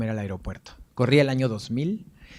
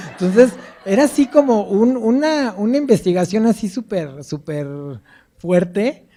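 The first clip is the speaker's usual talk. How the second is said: much higher and louder, through laughter; laughing; 8 semitones higher, 6 dB louder than usual. Real, not acted. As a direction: laugh as you tell it. Laughs retelling the interrogation. (contando entre risas)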